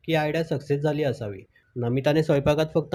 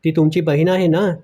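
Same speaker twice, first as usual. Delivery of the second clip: mildly shocked narrated exclamation, rising at the end; shocked near-scream; 2 semitones higher, 7 dB louder than usual. A narrator's reading. Wife reacts in shock to her husband's proposal, mild near-scream. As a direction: read the startled question with restrained alarm. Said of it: "Namita जवळजवळ किंचाळलीच".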